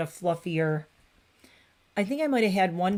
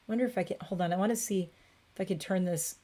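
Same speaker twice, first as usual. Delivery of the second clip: ordinary delivery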